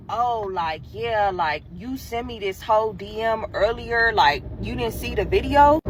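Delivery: low, soft guy voice; imitating awkward guy. Boy explains about the video she sent, imitated low and quiet. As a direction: drop low and quiet to mimic him.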